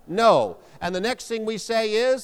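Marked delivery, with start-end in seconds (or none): 0.1-0.6 loud, firm "No"; leading a congregation to say it aloud. Shown as a demonstration. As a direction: call the refusal out clearly. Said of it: "Say it out loud".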